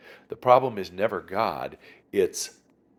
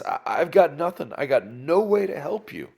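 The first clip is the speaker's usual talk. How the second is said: higher, louder retold protest; acted helpless protest; 9 semitones higher, 7 dB louder than usual. King telling a pleading woman he is helpless, protesting. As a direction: throw up your hands, voice raised.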